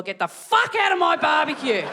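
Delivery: loud, acted outburst; angry retort. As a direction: burst out in mock outrage. Acts out butcher's angry retort.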